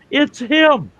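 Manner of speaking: can't believe your luck and let it show; amazed disbelief. Real, not acted.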